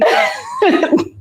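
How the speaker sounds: airy high laugh; breathy laugh. Real, not acted. Laughing at Mira.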